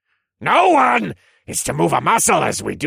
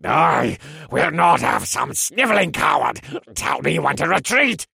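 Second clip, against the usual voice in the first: loud, raised, contemptuous elf general; sneering; 8 semitones higher, 6 dB louder than usual. A narrator's reading. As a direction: bellow it with a commander's scorn. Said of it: "the towering elf sneered".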